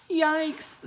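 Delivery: high-pitched yelp of surprise; startled yelp. A narrator's reading. Annie splashed by a wave, startled yelp. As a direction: yelp it high, caught off guard.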